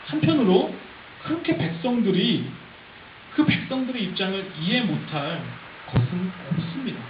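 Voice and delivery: ordinary talk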